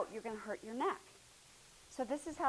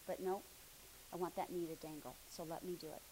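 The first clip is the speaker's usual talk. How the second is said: ordinary talk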